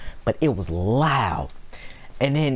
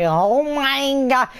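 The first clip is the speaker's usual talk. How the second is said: higher, louder, drawn-out oh my God; loud mock exclamation; 13 semitones higher, 4 dB louder, slower than usual. Streamer showing how he yells the line at work, loud mock voice. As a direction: belt it out like at work. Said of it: "loud at work".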